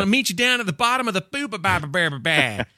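put-on high, cocky teenage brat voice; smack-talking teen voice. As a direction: talk trash like a snotty fifteen-year-old. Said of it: "smack talking little fifteen-year-old stupid teenager voice".

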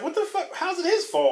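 high-pitched incredulous protest; exasperated, high re-enacted reaction; retold disbelief. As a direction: pitch it way up, baffled by the injustice. Retells own disbelief at the film.

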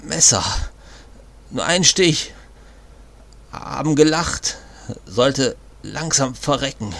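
strained, gasping dying man; bleeding out. Acted. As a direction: push each word out through pain. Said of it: "presste der Mann hervor".

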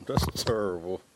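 put-on low, drawled DC accent. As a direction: lean into the DC accent. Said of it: "I gotta do my D C accent".

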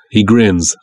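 ordinary delivery, nothing marked

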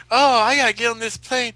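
a Southern belle who's in a hurry; Southern belle accent. Retold or imitated. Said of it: "She's from, uh, Tennessee".

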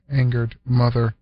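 ordinary delivery

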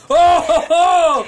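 high loud oh oh oh, mock astonished; shocked gasp. Mourners watching the corpse float up, shouting in shock. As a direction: gasp like mourners seeing a miracle.

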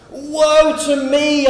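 slow, high, loud wail of woe; drawn-out loud lament; anguished cry. Acted. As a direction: stretch it out in loud despair. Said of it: "I cried".

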